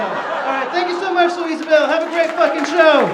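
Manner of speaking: loud, high goodbye to the crowd; shouted sign-off. Real, not acted. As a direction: yell the farewell over the room.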